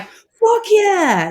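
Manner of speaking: high, loud, cheering whoop; gung-ho American. Retold or imitated. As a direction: cheer like an eager American backer. Imitating enthusiastic Americans.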